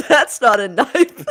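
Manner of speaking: loud, slow mock Australian through laughter; Australian accent. Host teasing co-host's failed accent, laughing Australian parody.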